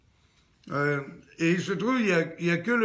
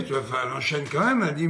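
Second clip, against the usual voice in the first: usual speaking voice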